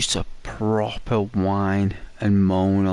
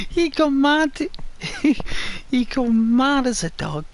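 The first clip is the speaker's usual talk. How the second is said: laughing high. Real, not acted. Laughing recalling the dog.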